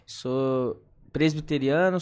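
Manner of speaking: ordinary talk, nothing marked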